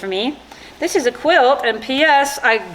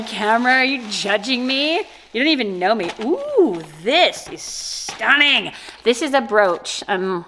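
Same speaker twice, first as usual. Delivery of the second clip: normal talking voice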